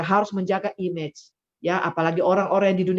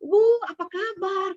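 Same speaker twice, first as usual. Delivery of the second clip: higher, slow friendly hello; a lightly voiced cheerful friend; friendly greeting; 13 semitones higher, slower than usual. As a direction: greet her kindly and cheerfully. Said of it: "Kok saya ketemu dia selalu".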